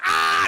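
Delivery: loud, high acted scream; imitated shriek, high and loud; mock attack scream.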